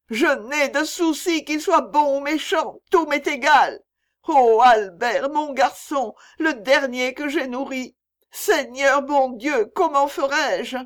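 high, loud wail of grief; despairing lament. A narrator's reading. Mother losing Albert, her last child, high despairing lament. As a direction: cry out in utter despair.

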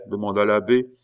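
usual speaking voice

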